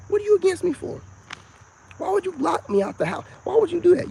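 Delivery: pleading confrontation. Retold or imitated. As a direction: ask it high, like a son who can't understand. Confronting dad in retold scene.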